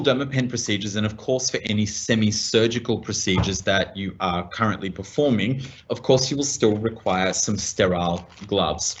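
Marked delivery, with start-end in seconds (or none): none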